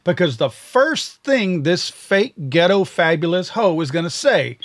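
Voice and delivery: ordinary talk, nothing marked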